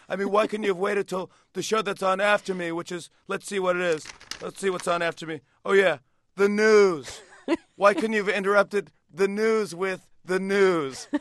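high, loud Trump voice, slowing on the punchline; Donald Trump impression. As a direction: drag out the news gag as Trump. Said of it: "I did a Donald Trump impression".

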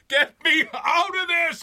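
high, loud desperate bawl. A narrator's reading. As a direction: yell as if trapped in a mine. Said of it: "I bawled".

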